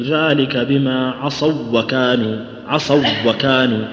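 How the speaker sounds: slow, عصوا وكانوا with the و of عصوا doubled into وكانوا; a clear idgham in عصوا وكانوا; merged reading. Shown as a demonstration. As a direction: merge the two waws of عصوا وكانوا, slowly. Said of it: "ندغمها".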